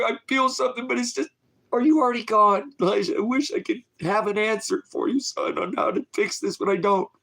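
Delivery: a father whose voice cracks as he pleads; breaking down crying. Real, not acted. Father begging his missing son to hang on, voice breaking. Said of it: "I apologize, you know, for breaking down".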